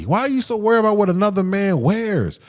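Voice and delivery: ordinary talk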